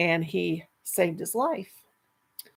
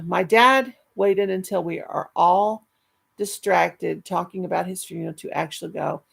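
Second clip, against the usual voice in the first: ordinary delivery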